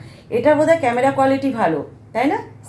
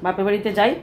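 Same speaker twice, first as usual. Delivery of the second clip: ordinary delivery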